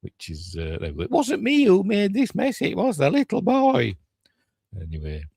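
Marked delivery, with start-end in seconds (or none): 1.0-4.0 very high-pitched put-on child voice; child's protest. Child blaming a ghost for the mess, high mock child voice. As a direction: plead innocence in a high little kid voice.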